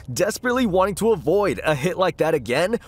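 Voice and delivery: plain reading voice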